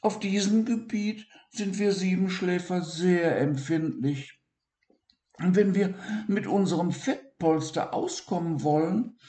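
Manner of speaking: high, small dormouse voice; Siggi voice. Acted. Siggi explains dormouse needs.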